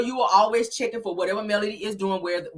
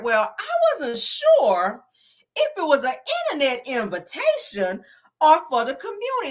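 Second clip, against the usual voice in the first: mimicking feigned uncertainty; 5 semitones higher than usual. Mimicking Kimmy's halting excuse.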